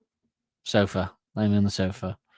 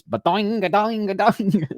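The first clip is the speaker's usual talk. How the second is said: high, slow springy boinging; cartoon boing; 9 semitones higher, slower than usual. Elmer Fudd's eyes popping at Bugs, high springy boings. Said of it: "And he goes".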